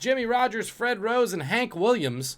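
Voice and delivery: game show question delivery; quiz host read. Acted. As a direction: announce it like a trivia host.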